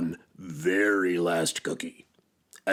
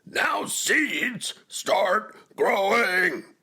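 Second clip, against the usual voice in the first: high, drawn-out full shout; 12 semitones higher, 5 dB louder, much slower than usual. A narrator's reading. Toad shouts at his seeds, long high yell. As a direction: shout the order, stretching the last word right out. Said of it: "and shouted".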